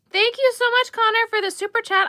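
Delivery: gushing over a super chat; excited thanks. Real, not acted. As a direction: squeal out the thanks to Connor. Thanking a viewer for donation.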